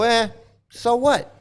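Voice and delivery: high dismissive shrug; lightly mocked indifference. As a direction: brush it off like a careless follower. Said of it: "and you sit there and go".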